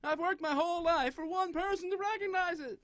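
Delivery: high, exaggerated comic voice; silly put-on voice. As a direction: keep the goofy high voice going.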